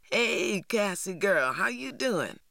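a breezy, too-friendly hello; a hint of a brash teenage boy; T J's greeting. A narrator's reading. TJ greeting Cassie.

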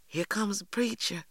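usual speaking voice

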